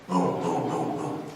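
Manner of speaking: low, booming footfalls; deep thudding elephant steps; imitated elephant footsteps. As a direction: make each boom a heavy, low thud.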